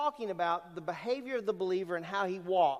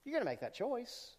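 softer, higher, almost confiding; soft repeat; 5 semitones higher, 6 dB quieter than usual. Shown as a demonstration. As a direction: say it gently, a quiet nudge. Preacher repeats line softer.

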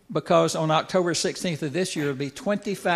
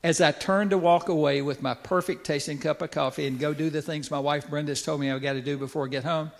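usual speaking voice

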